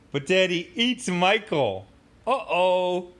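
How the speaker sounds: hammed-up uh-oh; mock dramatic. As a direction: play up the danger like a cartoon. Dramatic slug reveal.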